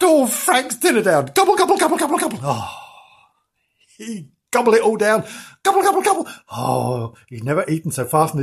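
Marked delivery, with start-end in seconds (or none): 1.3-2.4 high-pitched, rapid gobbling imitation; gobbling noise. Imitates cat gobbling food down. 5.6-6.3 high-pitched, rapid gobbling imitation; gobbling noise. Imitates cat gobbling food down.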